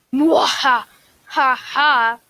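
hammy evil laugh; mustache twirling villain. Pretend. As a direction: cackle like a cartoon villain. Gloating over trap players.

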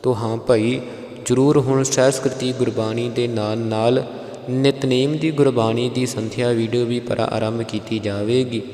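usual speaking voice

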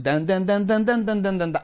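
rapid run of sung syllables; fast, bouncy imitation of piano scale; five-tone scale imitation. Shown as a demonstration. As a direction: rattle off a quick vocal-scale pattern. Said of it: "今のファイブトーンっていう".

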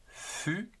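ordinary talk

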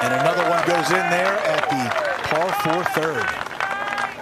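excited, slow play-by-play call; golf commentator. Acted. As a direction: call the putt dropping live.

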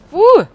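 loud high grunt of shock; a sharp shocked cry; startled grunt. Real, not acted. Player hit again, loud high shocked grunt.

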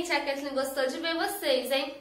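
ordinary talk

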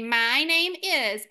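my name is drawn out slowly word by word; slow model phrase. Shown as a demonstration. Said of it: "So to say".

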